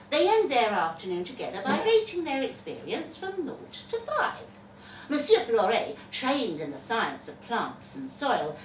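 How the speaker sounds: ordinary delivery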